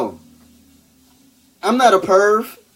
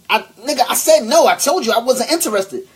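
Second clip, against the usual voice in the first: high, loud, indignant refusal; all extra; 10 semitones higher, 5 dB louder than usual. Shown as a demonstration. Showing ladies how not to say no, all extra. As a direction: refuse with over-the-top mock outrage. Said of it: "you don't have to be all extra with it".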